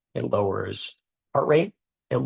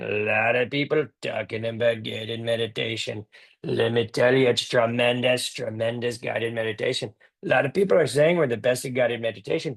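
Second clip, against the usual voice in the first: Donald Trump impression. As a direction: brag like Trump at a rally. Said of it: "Donald Trump do a guided meditation".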